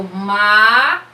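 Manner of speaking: "ma" drawn out long and loud; isolated syllable. Shown as a demonstration. Teacher separating macarrão for children, held first syllable. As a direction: hold the vowel of "ma". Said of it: "eu vou separar".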